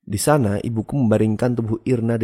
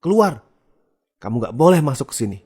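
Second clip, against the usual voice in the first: loud, raised yell; angry shout; 5 semitones higher, 5 dB louder than usual. A narrator's reading. Enraged mother orders child out of the basement, angry shout. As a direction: shout furiously to drive them out. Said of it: "Dia langsung teriak".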